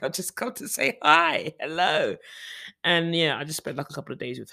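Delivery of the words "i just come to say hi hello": high, breezy hey-I'm-here voice; surprising mum at the door, high and breezy; casual cheery greeting. Retold or imitated. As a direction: sing it out like dropping by unannounced. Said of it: "It's like".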